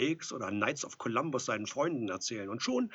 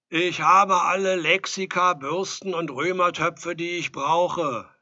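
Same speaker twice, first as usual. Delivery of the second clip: loud, slow, raised-pitch call; weary calling out; 8 semitones higher, 14 dB louder, slower than usual. A narrator's reading. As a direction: shout it wearily, stretching the words. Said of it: "rief Edelstein müde".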